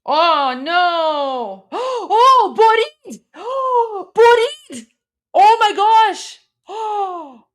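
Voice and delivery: high, loud cries of disbelief; excited shrieking. Real, not acted. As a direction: shriek with joy, gasping. Partner wins the round.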